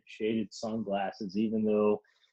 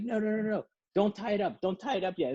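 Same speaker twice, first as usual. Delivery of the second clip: much higher, urgent mock voice; high insistent voice; 10 semitones higher, faster than usual. Other woman stopping the rope plan, high urgent mock voice. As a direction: stop them, squeaky and urgent. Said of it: "the other chick is like".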